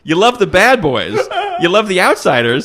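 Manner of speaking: high, loud, laughing sermon; laughing mock lecture. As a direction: preach it while cracking up.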